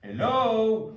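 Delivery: loud, high French take on a Belgian hello; a Frenchman hamming up Belgian; mock Belgian accent. Frenchman mocking Belgians, loud caricatured Belgian hello. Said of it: "il essaie d'imiter l'accent belge".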